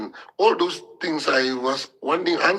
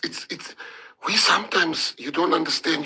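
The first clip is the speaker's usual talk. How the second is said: ordinary talk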